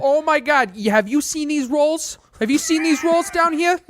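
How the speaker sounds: high, hammed-up Boston-style raving; East Coast accent. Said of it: "we're terrible at this accent".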